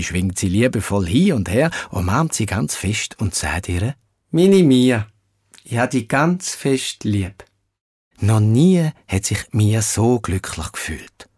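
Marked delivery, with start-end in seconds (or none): 4.3-7.4 tender father voice. Acted. Father hugs daughter tenderly.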